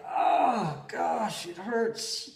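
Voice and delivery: mock groan of effort, starting high; hammed-up aching complaint; pained reluctance. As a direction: act out grudgingly forcing yourself along. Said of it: "so finally I did, but I was like".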